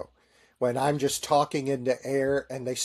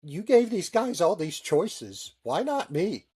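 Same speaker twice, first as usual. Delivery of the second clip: higher, slow whining complaint; mock complaint; 6 semitones higher than usual. Chaplain protesting to the detailer, higher whiny plea. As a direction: plead like a passed-over kid. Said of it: "I said".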